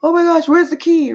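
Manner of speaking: sudden high, loud cry of alarm with a gasp; mother realising the key is missing, high alarmed cry; panicked shock. Retold or imitated. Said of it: "I'm like".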